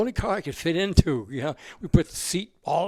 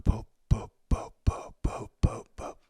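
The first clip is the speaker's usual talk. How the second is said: mimicked boop noise. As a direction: puff out little popping boops. Imitating a machine sound.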